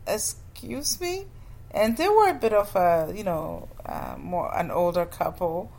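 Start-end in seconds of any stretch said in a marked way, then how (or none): 0.1-1.2 high, slow, softer incredulous question; drawn-out disbelief; incredulous excuse me. Retold or imitated. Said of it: "and I was like".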